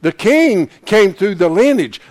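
usual speaking voice

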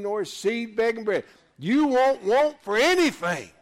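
loud, high revival preaching; full-force preaching. Acted. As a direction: shout it out to the congregation.

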